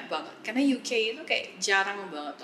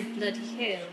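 put-on softer British-accented mild curse; British exclamation; 5 dB quieter than usual. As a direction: mutter it like an older Brit. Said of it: "they like to say". (umpatan pelan logat British)

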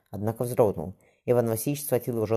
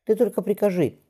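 higher, louder, a nervous quaver; trembling voice; 10 semitones higher, 5 dB louder than usual. A narrator's reading. Boyar frightened before the tsar, trembling voice. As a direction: plead fast before the tsar loses patience. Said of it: "дрожащим голосом проговорил окольничий".

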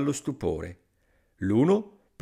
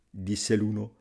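ordinary delivery

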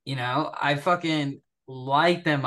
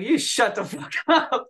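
high, loud mock yell with a laugh in it; retold yell back; 9 semitones higher, 4 dB louder than usual. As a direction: fire it back at him, half laughing. Shouting back at staff sergeant.

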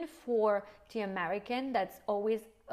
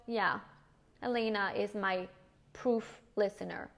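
ordinary delivery

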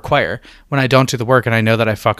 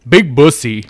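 light gym teacher bark; 4 dB louder, slower than usual. Shown as a demonstration.

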